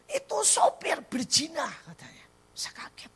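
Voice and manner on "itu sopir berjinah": high-pitched mimicked child's outcry; imitated child's cry. Child reporting the driver to mother, imitated cry. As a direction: imitate a child crying out an alarm. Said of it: "Dia teriak".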